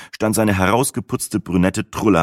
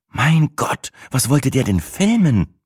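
higher, horrified question; aghast; 7 semitones higher, slower than usual. A narrator's reading. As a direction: gasp it out in disbelief. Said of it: "fragte Sina entsetzt".

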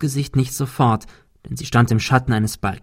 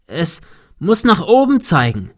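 raised, higher call against the storm; calling into the wind; 6 semitones higher than usual. A narrator's reading. Thomas calls to Cecil on the roof against the wind, raised voice. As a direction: shout it up to Cecil over the wind. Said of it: "rief Thomas dem Wind entgegen".

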